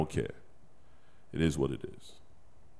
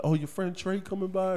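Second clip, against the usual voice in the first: higher, lightly voiced child asking; kid voice; 11 semitones higher than usual. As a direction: imitate an excited little kid. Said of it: "He be like".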